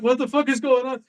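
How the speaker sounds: high-pitched baffled quote; mock bewilderment. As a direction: act out a startled "what's this?" reaction. Voices panicking cats' reaction.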